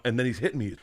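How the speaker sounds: usual speaking voice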